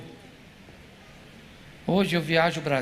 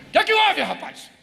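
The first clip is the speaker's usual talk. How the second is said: much higher, loud shout at the son; loud yell; 11 semitones higher, 5 dB louder than usual. Retold or imitated. Father yells at his son so the crying wife can hear. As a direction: bellow it so the wife hears in the next room. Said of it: "Gritei alto".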